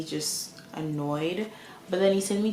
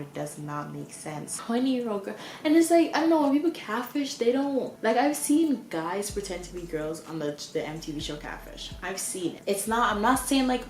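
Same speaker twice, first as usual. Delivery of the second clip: ordinary talk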